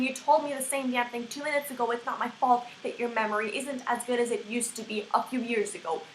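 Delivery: usual speaking voice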